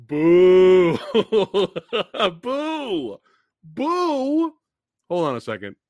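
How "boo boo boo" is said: playful high boos through laughter; mock booing. Booing the PG-13 trailer to his viewers, mock boos. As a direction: boo the trailer like a heckler. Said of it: "y'all know what I'm booing".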